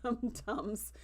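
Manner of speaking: slow words broken by laughter; laughing through the joke. Real, not acted. Vlogger laughing as she jokes about the cadets.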